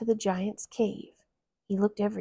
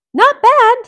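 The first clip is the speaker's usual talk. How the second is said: Mickey voice; 17 dB louder than usual. Acted. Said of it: "he told the giant".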